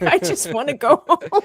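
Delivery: high, laughing plea to escape; laughing mock plea. Introvert at a crowded meetup wanting home, laughing plea. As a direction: plead to leave through helpless laughter.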